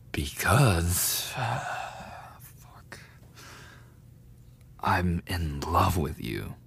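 sighing, hushed admission of love; whispered confession. Acted. Bully whispers a love confession to the victim. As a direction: let it out like a secret.

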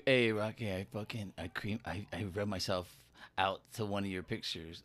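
low, soft confession in a put-on voice; creepy admirer voice. Confessing to Rocky about his pictures, creepy admirer voice. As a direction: murmur it low like a secret fan. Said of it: "not with that voice".

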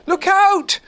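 very high, loud shout; cried out warning. A narrator's reading. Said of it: "cried Christopher Robin to the others".

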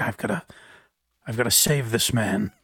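low, hushed mock-heroic resolve; mock Chili DeCastro. Co-host mocking the storyteller's rescue tale, low mock-heroic. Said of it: "Chili DeCastro's like".

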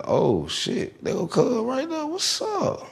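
high, strained shock; a kid's startled gasp at the handcuffs. Retold or imitated. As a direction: voice leaps up in disbelief. Sees friend in handcuffs.

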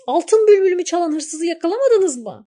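high, loud accusing question; angry shout. A narrator's reading. Girl presses wolf and jackal, high loud demand. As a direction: demand to know why they failed. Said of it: "diye bağırmış".

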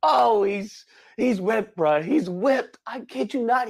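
high, mock-sobbing lament over Ken; hammed-up weepy lament; mock crying. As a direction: wail it like a fake heartbroken cry. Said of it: "I was like".

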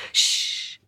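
unvoiced hush; whispered shh. Blind man warns of the elephant, whispering. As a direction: hush him urgently.

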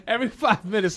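normal talking voice

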